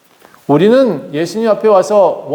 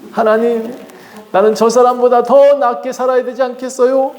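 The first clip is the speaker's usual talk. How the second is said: pleading prayer climbing ever higher; greedy prayer; 7 semitones higher than usual. Acted. Preacher mocking greedy church prayers, pleading rising higher and higher. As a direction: beg God for more like a jealous churchgoer. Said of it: "무슨 기도예요?".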